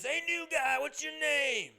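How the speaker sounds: high, loud, slow mock yell at the new guy; loud drunk regular. Dopey drunk barfly hollering at newcomer, high loud mock yell. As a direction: ham up a dopey barfly hollering across the bar.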